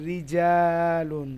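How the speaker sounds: রিজালুন very slow, light "ri" and long-held "jaa"; thin Ra. Shown as a demonstration. Teacher gives the zer example, রিজালুন slow with thin Ra. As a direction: draw out রিজালুন from a thin, clear R. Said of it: "পাতলা হবে".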